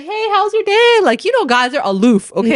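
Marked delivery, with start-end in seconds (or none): 0.0-1.0 much higher, breezy mock husband greeting; airy imitation of an oblivious partner; cheery husband. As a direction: toss it off like a clueless happy guy. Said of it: "He's like".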